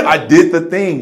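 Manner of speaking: loud, high, laughing repeat; laughing echo. Real, not acted. Laughing echo of friend's line.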